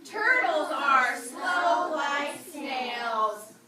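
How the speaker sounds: loud, very slow group recitation; stretched-out, booming classroom chant; choral read-aloud. Shown as a demonstration. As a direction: chant it out slowly, word by word. Class reads poem part aloud together.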